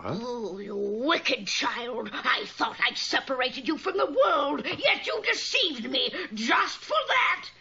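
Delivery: put-on a touch of a growling old witch; scolding witch. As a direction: berate the girl like a wicked witch.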